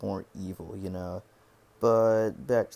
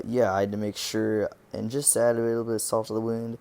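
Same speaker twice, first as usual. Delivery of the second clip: normal talking voice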